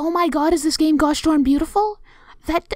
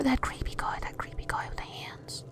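voiceless, hushed dread; creepy whisper; 3 semitones lower, 14 dB quieter than usual. Real, not acted. Player recalling the long-armed monster, hushed whisper. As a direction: whisper it like a scary secret.

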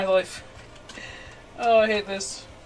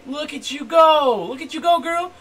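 cheering on; 7 semitones higher, 6 dB louder than usual. Real, not acted. Cheers on the creature.

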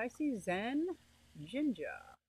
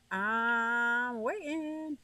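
drawn-out waiting; much slower than usual. Pretend. Impatient streamer waiting on chat.

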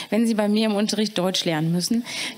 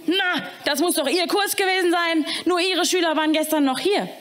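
higher, put-on caretaker voice; accusing janitor; 6 semitones higher than usual. Caretaker Eddie blaming the teacher's class, higher put-on voice. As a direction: pin the blame on her class.

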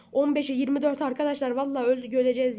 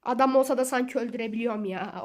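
ordinary delivery

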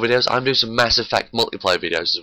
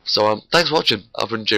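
ordinary delivery

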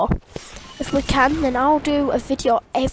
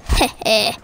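chuckling; 4 semitones lower than usual. Real, not acted. Gamer taunting enemies.

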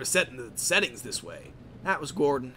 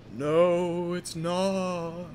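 drawn-out ghostly voice; 3 semitones higher, much slower than usual. Acted. Ghost of Diesel contradicting his killer, drawn-out ghostly voice. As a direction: intone it like a ghost. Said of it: "said the ghost of Diesel".

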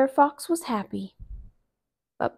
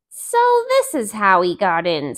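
Brer Fox; 6 dB louder than usual. Acted. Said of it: "said Brer Fox".

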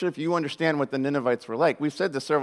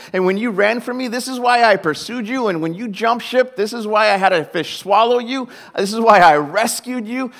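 higher, louder, beaming with joy; excited God; 6 semitones higher, 6 dB louder than usual. Retold or imitated. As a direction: cheer Jonah on like a proud partner. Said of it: "how excited God must be".

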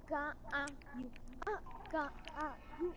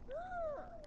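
mock gasp; 13 semitones higher, 7 dB quieter than usual. Shocked at being left out.

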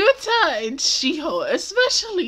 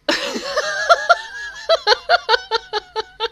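high long laugh. Real, not acted. Laughing at scene.

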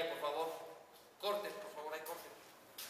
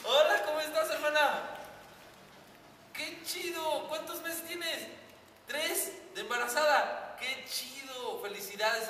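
llamada fingida; 5 semitones higher, 4 dB louder than usual. Pretend. Llamada fingida con teléfono derecho.

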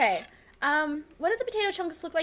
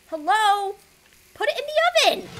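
higher, louder annoyed plea at the game; fed up with the oven; exasperated; 7 semitones higher, 6 dB louder, faster than usual. Real, not acted. Oven refuses the plate.

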